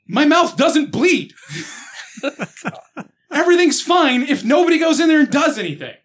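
loud laughing rant, high; laughing shout. Real, not acted. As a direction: yell it through laughter.

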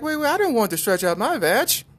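high, loud mock protest; hammed-up high squeal of objection; Lucy. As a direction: object in a silly high-pitched voice. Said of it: "Lucy was like".

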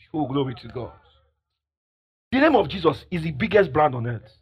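ordinary talk

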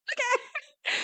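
very high, cracking little okay; squeaky okay. Retold or imitated. Woman quoting a flustered reply in a tiny high squeak. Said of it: "she's like".

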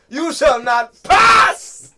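slow, high, loud hammed-up command; dramatic shout. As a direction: boom it like a wizard blocking a bridge. Mock Gandalf command at checkout.

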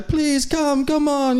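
high eager invite; lightly voiced Russian neighbour calling him over; imitating Russian neighbours. Russian neighbour beckoning the preacher, high eager call.

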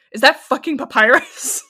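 loud, outraged mock rant; a professor stopping mid-critique in disgust. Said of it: "He's like".

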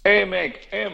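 high-pitched eager hail. Retold or imitated. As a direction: call out to an old pal. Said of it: "se dirige vers moi".

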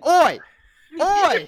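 high, loud mock "Oi!"; copper shout. Heist crew told off by guards, hammed-up copper shouts. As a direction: bark it like a bobby. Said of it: "they just have to say".

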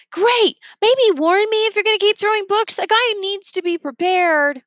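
put-on high, teasing boy's voice; boy Remy's voice. Remy voiced in story.

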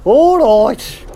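ordinary talk

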